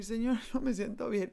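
tearful plea with a laugh breaking in; half laughing, half crying, asking for help. Retold or imitated. Pleading with the man while crying. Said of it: "Y yo llorando".

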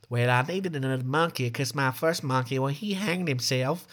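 put-on voice, offhand and drawn out at the end; nonchalant woman. Host imitates woman explaining her second monkey, nonchalant. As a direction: imitate her shrugging off the bad news. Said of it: "She says it so nonchalant".

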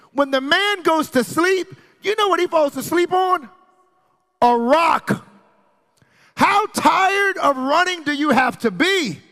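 full-force preaching. Real, not acted. Preacher pressing Jacob's desperation on the congregation, full-force preaching. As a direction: hammer each phrase high, with pauses between.

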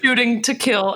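ordinary talk, nothing marked

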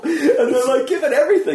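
high, loud, amused telling; laughing. Real, not acted. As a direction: keep talking through real laughter. Laughing about MCs trying hard.

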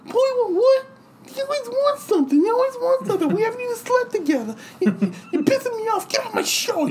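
very high squeaky Tyson voice, indignant; angry Tyson impression. Tyson yelling at the parrot on his show, very high and indignant. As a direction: squeak out the outrage. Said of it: "Tyson angry at bird".

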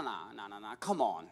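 ordinary talk

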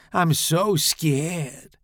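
slow, high drawn-out sarcasm; sarcastic mock fear. A narrator's reading. Tommy taunts Charles, slow sarcastic fake fear. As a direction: pretend to be scared, dripping sarcasm.

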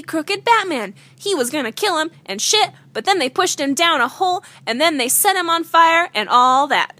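ordinary talk

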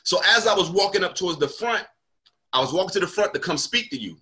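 usual speaking voice

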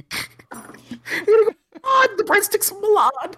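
squealing high, talking through laughter; slow, high-pitched words squeezed out while cracking up; helpless laughter. Real, not acted. Teacher cracks up retelling the Olive Garden joke, helpless laughter.

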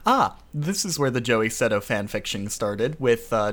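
ordinary talk

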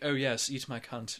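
slow, refined British woman; posh British accent. Shown as a demonstration. Co-host contrasting dialects for laughs, posh British accent. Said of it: "your posh".

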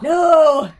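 loud high shout of no; angry toddler yell. Acted. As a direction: shout it to push him away. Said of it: "Tommy yelled out".

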